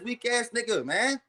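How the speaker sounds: normal talking voice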